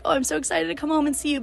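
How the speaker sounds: high-pitched mock excitement; imagined excited reply. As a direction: voice the eager answer you couldn't give. Voices the excited reply she couldn't give.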